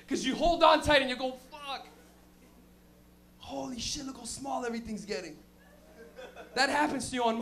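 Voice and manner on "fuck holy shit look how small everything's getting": soft awed murmur; hushed wonder at the height; a rider rising on Drop Zone, softly awed. Retold or imitated.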